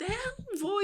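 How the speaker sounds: high-pitched, mock childish refusal; irritated child. Boy refusing his carer, irritated. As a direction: imitate an irritated boy digging his heels in. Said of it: "muito, muito irritado".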